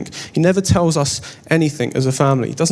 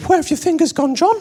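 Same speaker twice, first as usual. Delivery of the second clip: panicked blurt; 13 semitones higher than usual. Retold or imitated. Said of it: "I panic and said".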